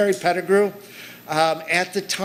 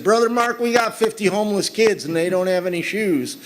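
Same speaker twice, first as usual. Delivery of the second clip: put-on Southern accent; 6 semitones higher than usual. Tugboat operator telling Brother Mark about shoeless kids, Southern accent. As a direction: put on your best Southern accent, pitched up. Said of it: "That's my best Southern accent".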